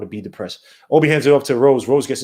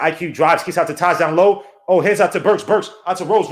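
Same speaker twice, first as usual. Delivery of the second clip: ordinary talk, nothing marked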